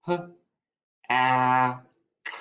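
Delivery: "P, a, k" as puh, short a, k, far apart; sounded out. Shown as a demonstration. Teacher writes the second syllable pack, sounding out P, a, k separately. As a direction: sound out P, a, k one at a time. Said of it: "My second syllable".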